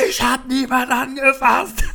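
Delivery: very high, loud, dragged-out mock screech; screeching denial from an angry drunk; imitated yelling drunk. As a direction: caricature a man shrieking his innocence. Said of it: "Der hat mich dermaßen angeschrien".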